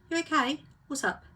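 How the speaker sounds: a breezy, lightly voiced "you okay, what's up"; mock casual concern. Partner casually asks the sulking one what's wrong, mock breezy. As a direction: toss it off like an oblivious friend checking in.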